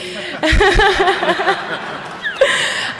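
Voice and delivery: high rising nervous giggle; nervous laugh. Real, not acted. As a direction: laugh off the jitters. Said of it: "I'm a little nervous".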